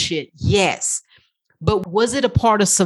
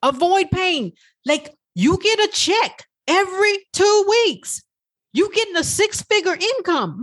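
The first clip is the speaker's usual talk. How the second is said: higher voice of the brain warning; 7 semitones higher than usual. Retold or imitated. Her brain reminding her of the paycheck, higher pitched. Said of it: "It was like".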